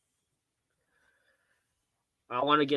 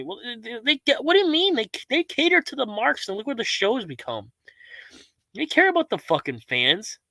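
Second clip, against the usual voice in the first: mocking voice; 8 semitones higher than usual. Mocking a viewer's wrestling argument.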